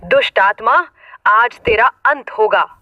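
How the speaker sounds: ordinary delivery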